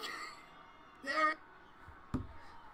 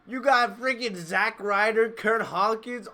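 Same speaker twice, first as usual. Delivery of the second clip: ordinary delivery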